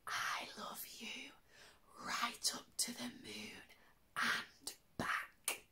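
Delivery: soft smiling whisper. Acted. Daddy hare whispering love to sleeping little hare. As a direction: whisper it tenderly with a smile. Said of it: "whispered with a smile".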